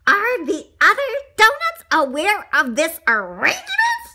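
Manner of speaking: high, loud indignant question; appalled donut character. A narrator's reading. Donut questions baker about others, appalled. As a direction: demand it in a high little voice.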